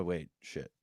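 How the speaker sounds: normal talking voice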